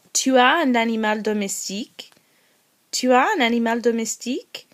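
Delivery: rising question tune at the end. Shown as a demonstration. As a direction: lift the end so the statement becomes a question. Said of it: "this is a question being asked using your tone".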